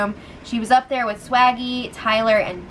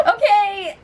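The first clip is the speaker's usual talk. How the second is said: incredulous shriek; 9 semitones higher than usual. Real, not acted. Host reacting to Caitlyn's odd claim, shrieked disbelief. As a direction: shriek it in mock outrage.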